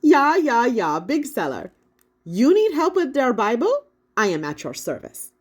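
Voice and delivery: put-on high, loud German accent, jovial; a proud old printer bragging in a German accent; jolly Gutenberg, German accent. A narrator's reading. Gutenberg boasts to the kids, jovial German accent.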